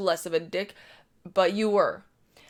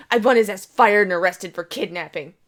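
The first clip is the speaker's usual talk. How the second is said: louder, indignant demanding woman; angry Karen voice; 7 dB louder than usual. Retold or imitated. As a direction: demand it like an entitled shopper.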